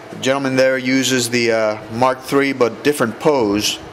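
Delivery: ordinary talk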